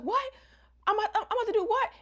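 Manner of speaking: very high fast panicked question; hammed-up alarmed squeak; mock shock. Agatha told she took a deadly pill, high fast mock panic.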